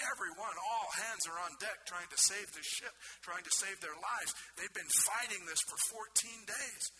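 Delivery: ordinary talk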